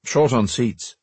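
usual speaking voice

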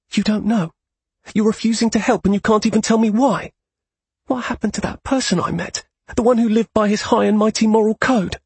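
high, furious accusation; angry. Acted. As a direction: lash out at him angrily. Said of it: "Neela was angrier than he ever thought possible".

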